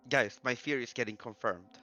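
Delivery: ordinary talk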